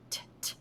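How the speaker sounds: "t" given as a bare unvoiced puff; whispered t sound. Shown as a demonstration. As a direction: isolate the final "t" as a breathy tap. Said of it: "I hear a T at the end".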